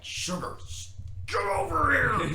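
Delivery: loud high slow yell at the dog; angry shout. Retold or imitated. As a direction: call the runaway dog with fury. Calling the dog, chasing.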